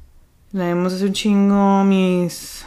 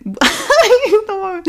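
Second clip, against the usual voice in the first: laughing at herself; 11 semitones higher, 3 dB louder, much slower than usual. Real, not acted. Laughing at own fumbling.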